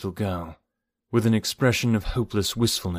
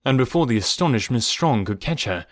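ordinary delivery

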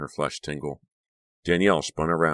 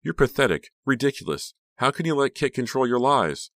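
high, loud scornful outburst; pitched-up, angry tirade; screaming; 10 semitones higher, 5 dB louder than usual. A narrator's reading. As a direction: yell the insults in outrage. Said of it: "Danielle screamed".

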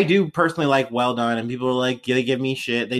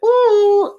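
excited high ooh; 8 dB louder than usual. Retold or imitated. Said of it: "And I was like".

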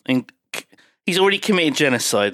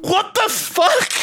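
very high, drawn-out disbelieving squeal; real high-pitched astonishment; incredulous outburst; much slower than usual. Real, not acted. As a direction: stretch it out high in sheer disbelief. Outraged reaction to film's line.

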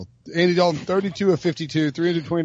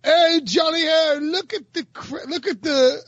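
high, put-on English accent with a slower start; a playful stab at an English accent; 13 semitones higher, slower than usual. English accent put on for a co-host who asked for it. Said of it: "you have to do this in your English accent".

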